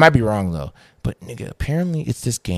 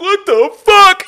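high, slow, stretched out in shock; drawn-out shocked squeal; shocked disbelief; 7 dB louder, much slower than usual. Retold or imitated. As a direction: recoil at a giant spider. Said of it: "I'm like".

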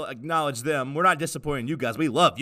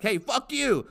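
dog yelling; 11 semitones higher, slower than usual. Pretend.

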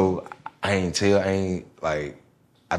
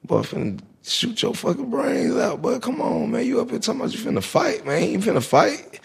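high, urgent scolding; Mama Debbie scolding the boy about the fight; scolding stepmom; 13 semitones higher than usual. Retold or imitated. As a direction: chew him out in a high voice. Stepmom scolding him, quoted.

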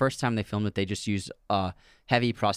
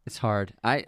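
ordinary talk, nothing marked

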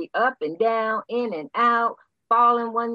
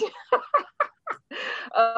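high burst of real laughter; bright giggle before a harsh truth; 13 semitones higher than usual. Real, not acted. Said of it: "smiling almost to the point of laughing".

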